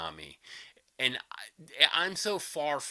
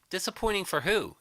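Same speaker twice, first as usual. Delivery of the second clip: normal talking voice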